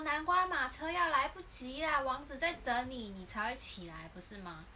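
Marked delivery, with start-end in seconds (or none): 0.1-3.1 high, bright call to get up; sing-song wake-up call to a child. Retold or imitated. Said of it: "我说".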